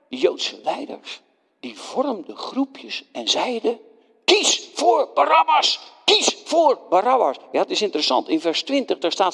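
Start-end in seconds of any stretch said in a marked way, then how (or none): 4.2-7.4 urging leaders. Acted.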